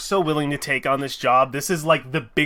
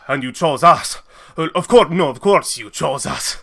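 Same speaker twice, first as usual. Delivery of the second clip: louder, incredulous delight; mildly hammed-up excitement; growing excitement; 7 dB louder than usual. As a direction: build with surprise, voice pushing louder. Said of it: "he gets more and more excited".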